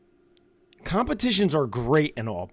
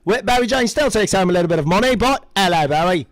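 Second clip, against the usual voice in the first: loud, hammed-up Aussie announcer; playful Australian impression at full volume; Bowie Jane Australian accent; 7 dB louder than usual. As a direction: belt it out in broad Australian. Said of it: "my Australian accent has gotten so much better".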